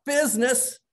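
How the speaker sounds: the ghost crying out in anguish; ghost cries out. Retold or imitated. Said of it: "the ghost cries out".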